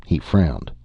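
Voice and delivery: plain reading voice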